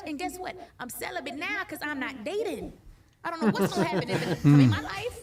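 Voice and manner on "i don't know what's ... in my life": laughing through the words. Real, not acted. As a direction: giggles while she admits it. Laughing confession about dating.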